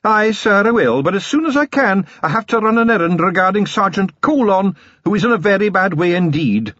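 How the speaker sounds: high Scottish wee man; tiny Scots pixie reporting; Scots Feegle voice. A narrator's reading. Wee Mad Arthur voiced.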